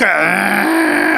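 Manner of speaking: preacher imitating a dog growling over a bone. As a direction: growl "ఏ" like a dog warning off another. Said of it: "అంటది".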